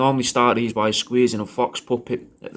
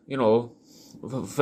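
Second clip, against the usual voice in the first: normal talking voice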